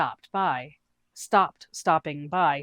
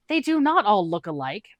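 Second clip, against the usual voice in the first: horrified; 4 semitones higher, 6 dB louder than usual. Acted.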